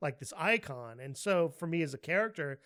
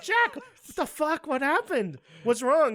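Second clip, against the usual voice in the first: high, loud, alarmed demand; retold panicked outburst; 11 semitones higher, 8 dB louder than usual. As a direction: demand answers in a rising panic, acting it out.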